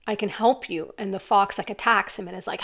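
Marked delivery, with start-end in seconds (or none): none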